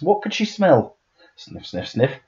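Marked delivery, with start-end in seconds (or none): 1.4-1.9 soft whispered dog sniffs; sniffing. A narrator's reading.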